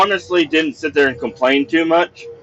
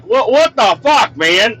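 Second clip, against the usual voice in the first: angry disbelief; 9 semitones higher than usual. Retold or imitated. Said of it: "I'm like".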